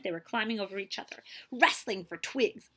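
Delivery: usual speaking voice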